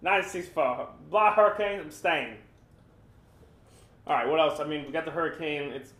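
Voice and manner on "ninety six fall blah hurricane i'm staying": louder, higher hammed-up North Carolina twang; laughing at the stubborn local in a North Carolina accent; teasing the holdout resident in a mock North Carolina accent. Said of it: "That's my North Carolina accent".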